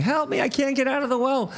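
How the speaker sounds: high, fast cry for help; mock scream. Man in a well calls to a passing priest, mock screaming. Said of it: "hears him screaming".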